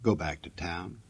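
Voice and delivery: ordinary delivery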